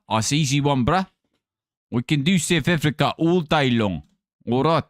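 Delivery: breezy South African accent; confident South African boast. Shown as a demonstration. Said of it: "I can do a South African accent".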